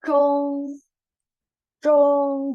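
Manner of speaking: Zhong: retroflex zh, level tone; careful Zhong. Shown as a demonstration. Teacher showing how Zhong is read. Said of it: "Ini bacanya seperti ini".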